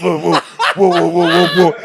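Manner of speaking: vocal sound effect of turbulence; imitated plane lurching. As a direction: mimic the lurching plane with repeated woos.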